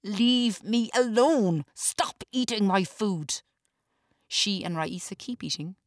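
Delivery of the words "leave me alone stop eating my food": slow, loud, high scream; furious yell. A narrator's reading. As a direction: scream them away from his gate. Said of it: "Walter screams at Zena".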